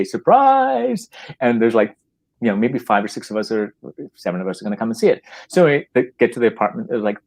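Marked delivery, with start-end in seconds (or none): 0.0-1.1 high excited cry of surprise; surprise shout. Retold or imitated. Friends shouting surprise down the phone to England. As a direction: yell it into the phone to surprise him.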